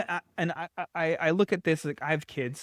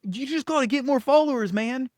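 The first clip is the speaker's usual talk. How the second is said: mock hype-bro voice, pitched much higher and louder; mock hype guru; 9 semitones higher, 4 dB louder than usual. Mocking a guru who pushes follower counts, high hype-bro voice. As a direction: ham up the guru selling followers. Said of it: "the bullshit lie that it's like".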